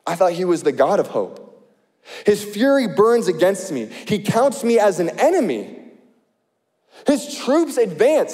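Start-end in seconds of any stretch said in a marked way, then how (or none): 2.3-8.3 earnest preacher reading scripture. Acted. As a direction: read Job's complaint with feeling. Reads Job's lament.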